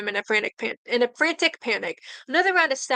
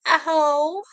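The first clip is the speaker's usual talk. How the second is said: cry out; 5 semitones higher than usual. Acted. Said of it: "he cries out, voice hoarse".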